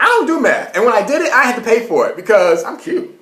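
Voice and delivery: high laughing denial; high indignant denial. Real, not acted. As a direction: protest sharply, then joke. Denying, then joking about meth.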